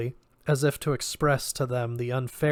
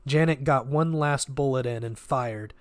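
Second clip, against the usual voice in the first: ordinary delivery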